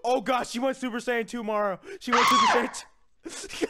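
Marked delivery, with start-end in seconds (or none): 2.0-3.7 very high, loud shriek; screaming gamer alarm. Real, not acted. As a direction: shriek it in shock at the enemy. Enemy transforms in game.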